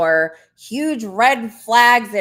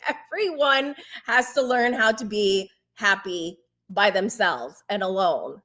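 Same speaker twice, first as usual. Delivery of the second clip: usual speaking voice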